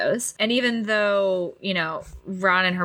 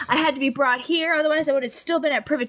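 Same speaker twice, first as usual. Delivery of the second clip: mock screaming rant; 8 semitones higher than usual. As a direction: rant like a bratty teen, pitched up. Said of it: "He starts screaming at them".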